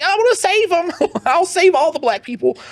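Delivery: sarcastic savior voice; mocking naive self. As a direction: parody your earnest younger self. Mocking his past woke self.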